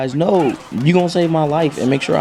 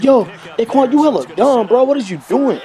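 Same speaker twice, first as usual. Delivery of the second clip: high, teasing friend voice; high put-on voice of a mocking buddy; 11 semitones higher than usual. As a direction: scold him like a friend calling him dumb. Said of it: "It was like".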